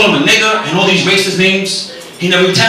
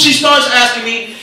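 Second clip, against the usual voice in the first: ordinary talk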